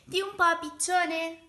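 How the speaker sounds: high, loud little mole voice; squeaking. A narrator's reading. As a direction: squeak it out at the pigeon. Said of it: "squittì". (vocina squittente)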